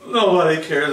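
loud, high-pitched cry of grievance; hammed-up loud wail; mock wailing. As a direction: bawl it out in self-pity. Acts out his dream outcry.

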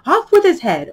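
high, loud royal yell; shouting. A narrator's reading. Queen sentencing the players, shouting. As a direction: shriek the sentence like an imperious Queen. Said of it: "quarrelling with the other players and shouting".

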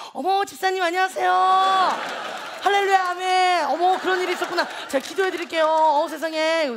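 imitating mom's sudden sweet church-friend greeting; 교양 있는 전화 목소리. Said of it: "혼내다가 또 전화 오면". (집사님 전화에 돌변한 엄마의 상냥한 말투 흉내)

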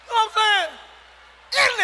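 drawn-out high shout at the crowd. Acted. Comic shouting to the crowd about the heat. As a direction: yell it slow and high.

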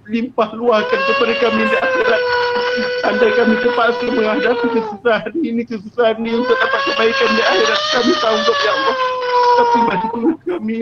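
fervent doa, voice cracking into sobs; tearful prayer. Real, not acted. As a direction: plead with God, near tears. Leads a tearful closing prayer. (doa penuh sebak dan rayuan)

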